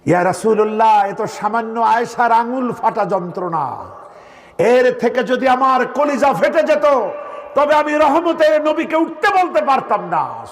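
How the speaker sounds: high, fervent preacher's quote; impassioned devotion. Retold or imitated. Preacher voicing Ayesha making light of pain for the Prophet, impassioned.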